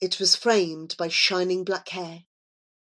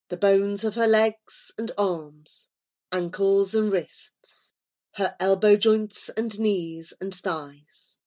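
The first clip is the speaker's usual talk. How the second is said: ordinary delivery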